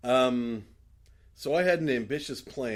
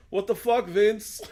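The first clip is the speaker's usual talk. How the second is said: [name]; 9 semitones higher, 7 dB louder, slower than usual. Retold or imitated. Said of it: "you hear [name] go".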